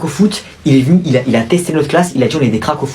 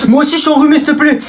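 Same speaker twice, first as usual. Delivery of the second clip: mock pleading; 12 semitones higher, faster than usual. Pupil begging the boy for tissues, high and pleading. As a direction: beg like a needy child.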